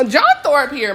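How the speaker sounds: ordinary talk, nothing marked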